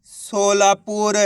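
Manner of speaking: drawn-out model word; slow blended reading. Shown as a demonstration. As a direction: join the syllables but keep each one long. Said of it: "इनको आपस में मिला के पढ़ना है". (अक्षर मिलाकर खींचकर पढ़ना)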